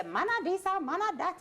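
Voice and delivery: mocking gossip. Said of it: "Everybody always say".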